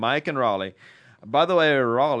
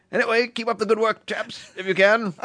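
put-on British accent; 6 semitones higher than usual. Closing the British listener's email in a British accent. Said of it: "a British accent".

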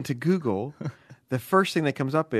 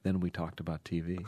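low, hushed dream recollection; sexy dream voice; 6 semitones lower, 10 dB quieter than usual. Acted. Letter writer recounting a dream, low dreamy voice. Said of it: "your sexy dream voice".

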